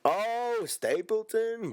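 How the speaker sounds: high mock awe at Stapleton; amazed coworker. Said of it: "You'll be like".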